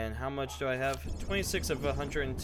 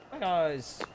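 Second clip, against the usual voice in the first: excited greeting; 6 semitones higher than usual. Real, not acted. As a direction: call the dogs in a silly voice. Greeting dogs.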